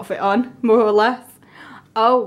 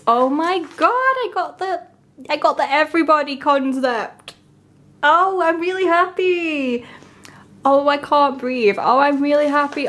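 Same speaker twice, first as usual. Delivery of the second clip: much higher, gushing with joy; overjoyed fangirl gush, pitched up high; excited squeal; 6 semitones higher than usual. Real, not acted. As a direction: squeal like you just won. Got her favourite concept.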